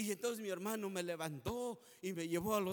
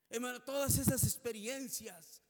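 louder, fervent preaching cadence; 5 dB louder than usual. Acted. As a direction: drive it home to the congregation. Preaching at full force.

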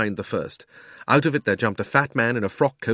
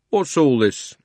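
london policeman; 5 semitones higher, 4 dB louder, slower than usual. A narrator's reading. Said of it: "said the policeman".

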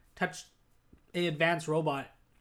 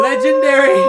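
excited shout; 16 dB louder than usual. Pretend. Mocking Obi-Wan noise.

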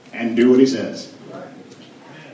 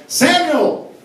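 much higher and louder call; 12 semitones higher, 6 dB louder than usual. Acted. God calling Samuel the third time, loud and high. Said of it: "Another exclamation point".

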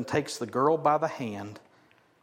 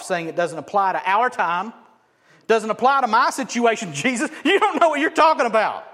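loud scornful objection, building higher; jeering scoff; 6 semitones higher, 8 dB louder than usual. Acted. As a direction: scoff at him, rising to a shout. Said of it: "We jeer and we scoff".

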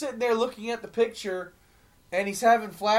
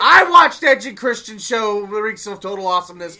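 excited; 9 dB louder than usual. Retold or imitated. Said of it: "I was like".

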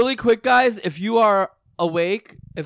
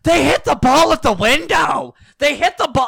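put-on high, loud tattling kids; excited kids voice; 9 semitones higher, 7 dB louder than usual. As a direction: shout it like a kid tattling. Kids reporting ball hit window.

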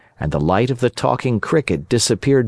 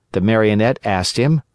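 ordinary delivery

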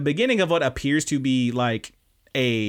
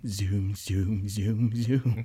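drooling zooming; 4 semitones lower, 5 dB quieter, much slower than usual. Retold or imitated. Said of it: "he's just drooling on his desk going".

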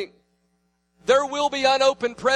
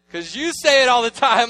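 innocent retort; 4 semitones higher, 8 dB louder than usual. Retold or imitated. Son answering his father innocently.

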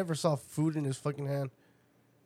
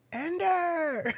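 high cheering parent shout; calling out; 14 semitones higher than usual. Retold or imitated. Imitating parents cheering for Ender, high shout. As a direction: yell the kid's name from the crowd. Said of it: "those other fucking parents were like".

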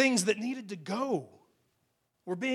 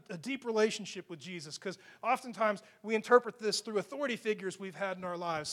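ordinary talk, nothing marked

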